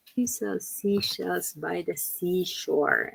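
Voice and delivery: slow, even tongue twister. Shown as a demonstration. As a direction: read it out slowly for learners. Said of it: "say it slower".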